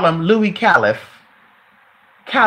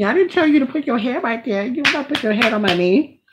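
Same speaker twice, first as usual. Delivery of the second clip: soothing pet talk; 5 semitones higher than usual. Real, not acted. Apologizing to the dog.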